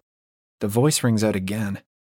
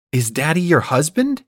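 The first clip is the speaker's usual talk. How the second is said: higher, incredulous rising question; dawning shock, pitched up; alarmed disbelief; 6 semitones higher than usual. A narrator's reading. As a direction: ask it as if dreading the answer. Shocked question to married lover.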